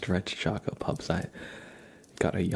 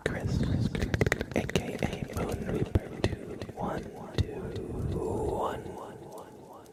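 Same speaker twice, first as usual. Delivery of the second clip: ASMR whisper; slower than usual. Acted. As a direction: breathe the words close to the mic. ASMR name trace whispered.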